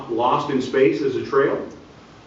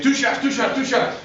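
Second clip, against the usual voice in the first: much higher, insistent yell; coach yelling; 10 semitones higher than usual. Retold or imitated. Coach hollering at the officials for two shots, higher yell. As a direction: demand the free throws. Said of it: "the one coach is saying".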